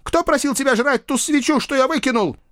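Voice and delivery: high, agitated rant; heated, high-pitched student outburst; losing his temper. A narrator's reading. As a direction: hurl blame back defensively. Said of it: "сорвался студент".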